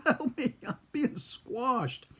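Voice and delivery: high, drawn-out mock wail for help; retold screaming. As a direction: wail like a panicked kid, half laughing. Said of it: "I kept screaming".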